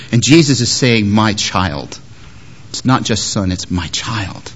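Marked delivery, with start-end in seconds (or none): 1.1-2.0 tender. Acted. Jesus tenderly addresses lame man. 3.7-4.5 hushed and tender, barely above a breath. Acted. Jesus softly calling the lame man his own child, tender. Repeated tender address, very soft.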